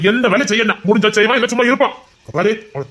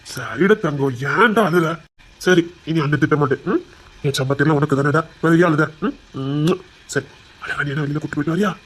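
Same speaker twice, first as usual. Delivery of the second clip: low, soft soothing voice; tender consoling; 5 semitones lower, 3 dB quieter than usual. Acted. Elder promising the crying boy no more scolding, tender consoling. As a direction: soothe gently, pitched well down.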